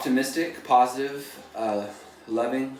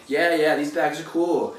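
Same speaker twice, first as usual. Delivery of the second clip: higher and louder, cheerful and open; upbeat; 5 semitones higher, 6 dB louder than usual. Retold or imitated. Flipping his mood about the grocery bags, upbeat and higher. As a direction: sell it like the bags just made your day. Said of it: "changed the nature of my frequency".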